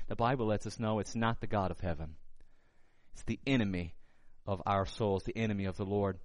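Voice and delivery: usual speaking voice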